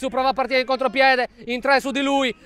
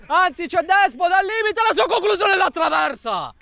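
excited commentary; 7 semitones higher than usual. Real, not acted. Shot hits crossbar.

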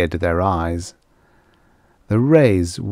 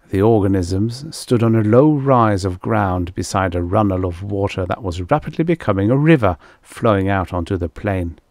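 usual speaking voice

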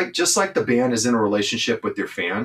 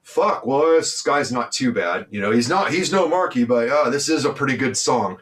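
mocking the haters; 5 semitones higher than usual. Mocking haters who will grudgingly praise the new singer. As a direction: sneer it out like a reluctant critic. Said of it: "what they're gonna go".

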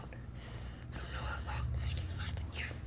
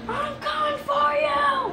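higher, slow, shouted threat from afar; menacing shout; 6 semitones higher, slower than usual. Acted. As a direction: call it out slowly like a villain hunting. Villain shouts threat in skit.

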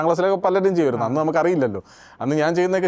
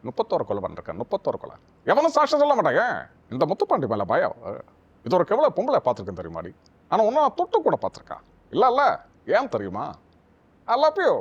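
Prakash Raj impression. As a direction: play Prakash Raj teasing his sweetheart. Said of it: "ഞങ്ങൾക്ക് വേണ്ടി ഒന്ന് ചെയ്യാവോ".

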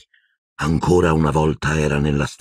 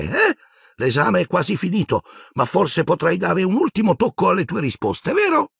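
put-on much higher, kindly old wizard. A narrator's reading. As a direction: play the doddery old examiner. Old wizard comforting Harry. (voce acuta del vecchio mago comprensivo)

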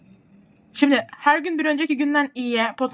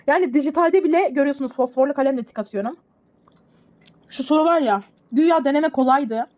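ordinary talk, nothing marked

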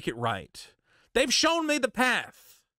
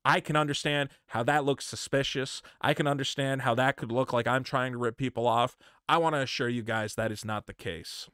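mock humble statement; 8 semitones lower than usual. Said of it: "for me to hold on to this money".

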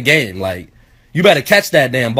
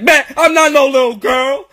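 high-pitched wounded protest; mad, emotional kid; 10 semitones higher, 3 dB louder than usual. Retold or imitated. Nine-year-old answering dad's insult, indignant and emotional. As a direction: imitate an upset child firing back. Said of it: "I start to get emotional".

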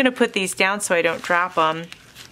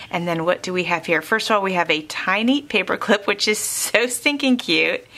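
ordinary talk, nothing marked

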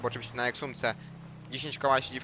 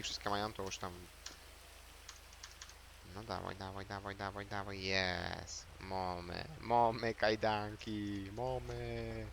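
quiet; 4 semitones lower, 11 dB quieter, slower than usual. Real, not acted. Willing game item, relief.

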